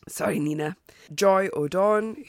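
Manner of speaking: ordinary talk, nothing marked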